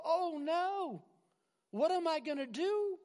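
high, wailing alarm; hammed-up cry of dismay; mock panic. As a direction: act out a helpless panic. Acts out panic when trouble comes.

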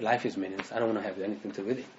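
low, soft, hurried mock despair; mildly downcast imitation. Preacher quoting people who find life meaningless, quietly downcast. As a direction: voice someone down in life, quick and hushed. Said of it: "down in life".

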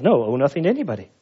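rattled off quickly as if obvious; quick mock-literal reading; fast, insistent. Shown as a demonstration. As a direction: hammer it home fast. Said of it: "Can you make it say anything else?".

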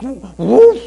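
loud, high cut-in woof; quick interrupting dog woof; dog bark. Acted. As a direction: bark over him eagerly. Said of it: "interrupts the dog".

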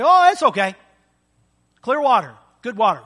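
high and loud, happily fooled; cheerful naive approval. Retold or imitated. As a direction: sound delighted with the clear water. Unaware drinker approves water.

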